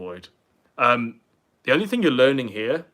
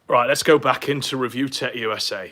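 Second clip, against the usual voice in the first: ordinary talk, nothing marked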